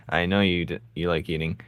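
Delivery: normal talking voice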